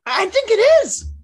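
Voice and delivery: high, loud, drawn-out insistence; teasing, stubborn I think it is; insistent. Real, not acted. Playful insistence in argument.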